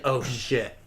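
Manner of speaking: low, hushed realization; quiet inner thought. Retold or imitated. Gamer watching the controller fly at the TV, quiet dismayed thought. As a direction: drop it right down, as if thinking it. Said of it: "and thinking".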